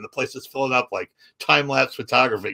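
ordinary talk, nothing marked

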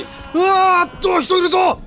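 high loud frightened yell; startled shout. Real, not acted. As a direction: cry out in shock. Startled by person in game.

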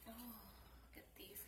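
slow, hushed whisper. Real, not acted. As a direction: breathe it out in a whisper. Whispering over the welts.

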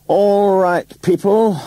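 high, loud, drawn-out announcement; calling out. A narrator's reading. Ronnie addressing the cast as rehearsal ends, calling out. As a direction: call the whole room to attention. Director calls the cast to order.

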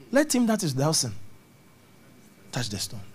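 ordinary talk, nothing marked

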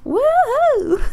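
high, loud whoop of triumph; exultant high-pitched cheer; triumphant yell. Acted. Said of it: "Allie yelled out in triumph".

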